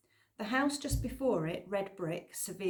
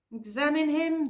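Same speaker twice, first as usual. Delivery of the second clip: sharp, irritated echo, higher and louder; tetchy; 8 semitones higher, 7 dB louder than usual. A narrator's reading. Doctor tetchily brushing off the worried girl, irritated. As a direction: snap the words back in disbelief. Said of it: "the doctor said tetchily".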